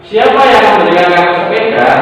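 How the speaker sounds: reads the question aloud with a clear question lilt; questioning tune. Shown as a demonstration. Teacher reading a sample question to pupils, with a clear question tune. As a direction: model the question for the class. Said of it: "Itu diakhiri oleh tanda tanya".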